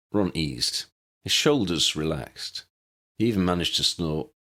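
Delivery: ordinary delivery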